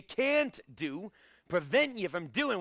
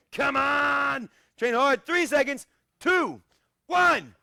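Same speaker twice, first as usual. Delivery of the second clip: much higher, loud coach yell counting down the last seconds; shouted countdown; 8 semitones higher, 8 dB louder, much slower than usual. Real, not acted. Coach urging his online class through the last seconds, shouting. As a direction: push the class home, shout it out. Final seconds of timed sit-ups.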